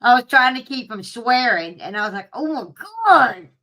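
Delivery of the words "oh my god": building to a loud, high cry; exasperated. Retold or imitated. Chasing dog into church.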